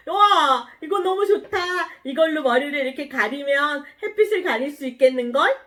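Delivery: put-on bright-eyed wonder for young listeners; delighted. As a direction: play Jonah thrilled by a lucky find. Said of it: "기분이 너무 좋았어요".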